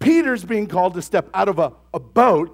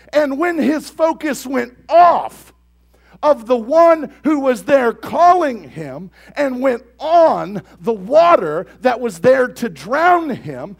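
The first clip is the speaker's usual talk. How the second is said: loud, slow, emphatic preaching; fervent sermon climax, loud and deliberate; preaching at full force; 4 semitones higher, 7 dB louder, slower than usual. Real, not acted. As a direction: drive the point home to the congregation.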